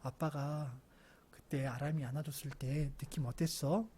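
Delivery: soft, low, gentle questioning; hushed, calm parent; quiet fatherly talk. Acted. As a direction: take the child aside and ask softly. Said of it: "제가 조용히 불러서".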